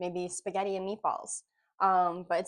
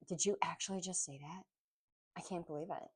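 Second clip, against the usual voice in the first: soft, lightly voiced girl; a hint of a young woman, hushed; 2 semitones lower, 10 dB quieter, faster than usual. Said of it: "I heard someone saying that in a girl voice".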